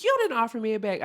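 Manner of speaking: high, loud, fast accusation; accusing customer. Retold or imitated. Customer accusing the cashier over a bag, high and indignant. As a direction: mimic an indignant customer firing a complaint. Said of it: "and she said".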